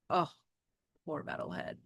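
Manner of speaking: soft whisper; whispered pity. Real, not acted. Reader sorry for the robot dog, whispered. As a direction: breathe it out in pity. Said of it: "my heart hurts for him".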